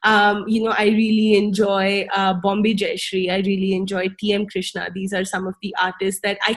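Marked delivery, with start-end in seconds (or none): none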